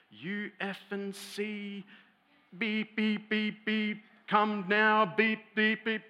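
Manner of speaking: high, loud furious tirade with bleeps; mock a girl screaming abuse, beeping out the swearing; imitated screaming rant. Girl screaming at boyfriend on the phone, imitated furious rant. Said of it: "she starts launching into this".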